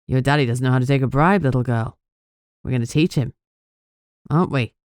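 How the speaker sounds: a hint of a cruel captor toying with her; menacing kidnapper. Kidnapper taunts captive girl.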